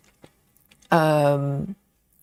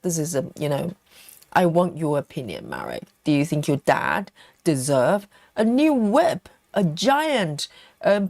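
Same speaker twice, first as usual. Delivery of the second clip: usual speaking voice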